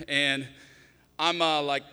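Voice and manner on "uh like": normal talking voice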